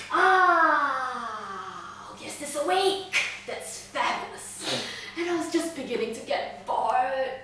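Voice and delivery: delighted villain, long drawn-out "Ah"; gleeful villain host. Acted. Captor greets waking prisoner, gleefully theatrical. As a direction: relish the arrival like a gleeful host. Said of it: "He's, like, really happy that you're alive".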